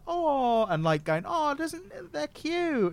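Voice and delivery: put-on high sing-song baby talk; cooing at a baby. Mocking how people talk down to him, high cooing voice. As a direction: coo like a grown-up fussing over an infant. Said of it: "everyone's talking to you like".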